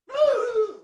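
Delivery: a sudden high laugh; embarrassed real laugh; burst of laughter. Real, not acted. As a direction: crack up at yourself. Laughing at his own voice crack.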